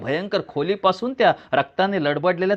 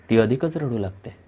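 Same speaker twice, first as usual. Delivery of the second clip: usual speaking voice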